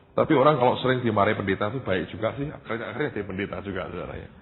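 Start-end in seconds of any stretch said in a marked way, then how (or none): none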